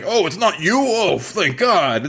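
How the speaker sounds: high, exaggerated surprise melting into relief; hammed-up relief, drawn out at the end; mock relief. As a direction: act out an over-relieved reaction. Mimics man's relief at meeting.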